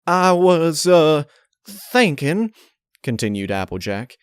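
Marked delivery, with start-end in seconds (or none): 0.1-2.5 high, loud and slow, hesitant stammer. A narrator's reading. Applejack stalls before Twilight, nervous stammer. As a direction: drag it out, stalling. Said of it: "Her stammering was getting annoying".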